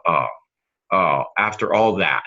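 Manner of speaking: ordinary talk, nothing marked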